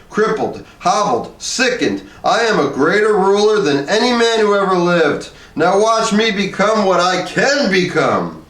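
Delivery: bellowing. A narrator's reading. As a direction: bellow it to the whole room. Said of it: "he bellowed".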